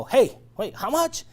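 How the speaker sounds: startled, high "how much?" with a rising end; surprised customer. Retold or imitated. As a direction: play a customer jolted by the price. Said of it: "Unless they stop you and go".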